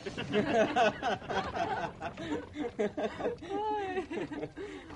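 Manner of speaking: drawn-out belly laugh; long hearty laugh. Acted. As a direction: laugh long at your own joke. Laughing at own joke.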